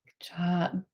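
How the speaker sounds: a soft unvoiced good job; whispered praise. Real, not acted. Teacher whispering praise to a student.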